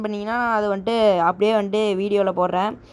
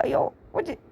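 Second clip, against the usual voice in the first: dismayed yelp; 9 semitones higher, 6 dB quieter than usual. Real, not acted. Dismayed as the fight goes wrong.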